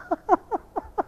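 quiet giggle rising up; a light chuckle at his own joke; soft high laugh. Real, not acted.

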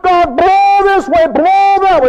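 high, loud, slow commanding God; hammed-up demanding prayer; mock bossy prayer. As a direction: order the wind around like a boss.

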